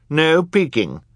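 high, loud stern reprimand. A narrator's reading. Judge forbidding the crowd to peek, stern. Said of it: "Justice Strauss said sternly".

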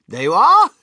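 high, very loud, rising "there you are"; triumphant cry. A narrator's reading. Wonka proving his point to doubters, triumphant. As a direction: crow it, proven right at last. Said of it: "he cried triumphantly".

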